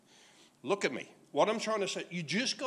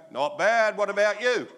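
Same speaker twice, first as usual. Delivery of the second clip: cheeky answer; 3 semitones higher, 6 dB louder than usual. Retold or imitated. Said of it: "and then I go".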